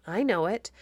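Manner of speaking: plain reading voice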